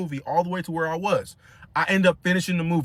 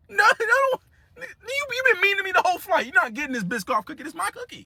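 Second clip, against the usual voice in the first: high whiny petty voice; mock childish taunt; 12 semitones higher than usual.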